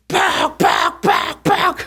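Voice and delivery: repeated high, forceful smacking noise; imitated smacks. Mother smacking sleeping child awake, imitated blows. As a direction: punch out sharp, high whack sounds.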